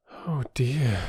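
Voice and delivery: a soft, sighing oh dear. Acted. Bobby facing the silent porter, sighing. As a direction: let it out on a sigh. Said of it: "said Bobby with a sigh".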